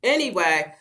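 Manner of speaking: ordinary talk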